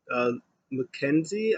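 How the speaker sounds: usual speaking voice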